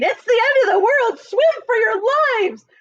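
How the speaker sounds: high, frantic shrieking; terrified carpenter shrieking, pitched high; panicked shriek. A narrator's reading. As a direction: scream it like the world is ending. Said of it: "The carpenter was shrieking".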